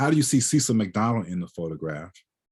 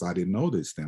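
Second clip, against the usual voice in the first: ordinary delivery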